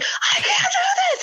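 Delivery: high laughing cry of defeat; retelling her labor cry to husband Dan; laughing mock wail. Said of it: "So I'm like".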